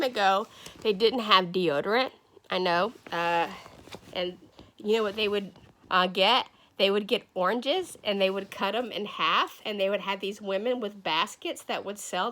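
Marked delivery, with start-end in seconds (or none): none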